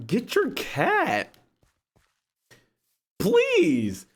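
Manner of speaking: high, slow desperate begging; pained plea. Retold or imitated. He pleads with her to take the cat, high and slow. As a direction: stretch it out, desperate.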